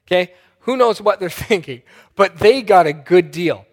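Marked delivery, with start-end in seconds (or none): none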